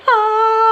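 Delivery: high, held angelic "ahh"; elated "ahh". Retold or imitated. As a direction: imitate a blissful moment with a long high note. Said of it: "he was, like, in this, like".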